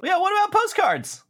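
high squeaky teasing voice; squeaky mock eager. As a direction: squeak it out like a cartoon.

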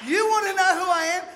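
squaring up with a loud retort; confrontational shout. Retold or imitated. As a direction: throw it back at her. Confronting the mother.

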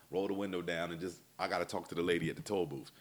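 ordinary talk

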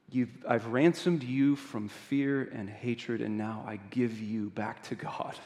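soft, measured declaration with long pauses; solemn, tender pardon. Acted. Bishop frees his brother Jean from evil, soft and solemn. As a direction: gently pronounce a blessing, phrase by phrase.

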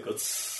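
match strike. Shown as a demonstration. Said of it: "have a little sound effect too".

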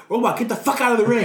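high, fast mock yell; retold yell at partner. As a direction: snap it at him like a scolding partner. Yelling at partner in ring.

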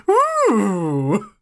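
high, loud flamboyant coo; Pegasus "Ooh!". Retold or imitated. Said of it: "just to be like".

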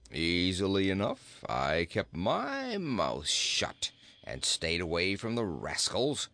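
low, drawn-out talking goat; dry, calm goat. Acted. Goat explains his escape to the boy, low and calm. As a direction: stretch it low and unruffled.

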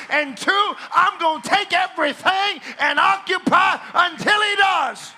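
loud, high declaring preacher; full-force preaching. Acted. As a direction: proclaim it like a revival preacher. Preaching climax.

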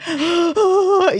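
loud high gasp of amazement; high stunned intake of breath; sharp high gasp. Retold or imitated. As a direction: gasp in shock. Reacting to the sign.

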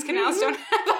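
helpless laugh climbing through the words; cracking up. Real, not acted. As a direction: lose it laughing while reading. Reader cracks up at absurd line.

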